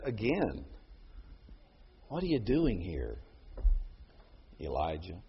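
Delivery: low, soft and tender; God speaking gently to Elijah; gentle. Acted. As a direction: ask it softly, like a breeze. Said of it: "a gentle breeze".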